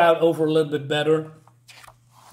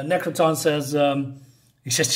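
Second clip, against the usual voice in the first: ordinary talk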